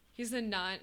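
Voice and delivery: ordinary delivery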